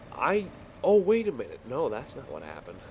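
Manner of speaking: normal talking voice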